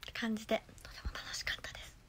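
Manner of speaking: fast hushed whisper. Real, not acted. After the cheer, telling chat it was fun in a whisper. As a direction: drop to a quick whisper.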